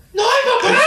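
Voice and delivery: put-on high, loud child shout through laughter; laughing while imitating his childhood yell; big kid yell. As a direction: belt it like a small kid from the toilet. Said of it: "qué vozarrón".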